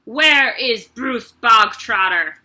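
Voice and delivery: loud slow demand; loud bullying headmistress; barked demand. Acted. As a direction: call him out before the whole school.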